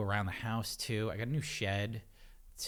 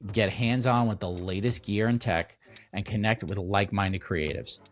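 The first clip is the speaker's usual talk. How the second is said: ad read; 7 dB louder than usual. Acted. Reads a conference ad.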